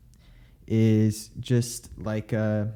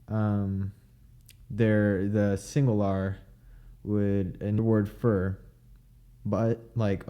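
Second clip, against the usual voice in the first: ordinary talk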